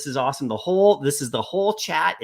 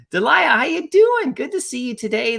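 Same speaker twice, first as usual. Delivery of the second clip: excited greeting; 9 semitones higher, 6 dB louder than usual. Real, not acted. Streamer greets arriving chatter.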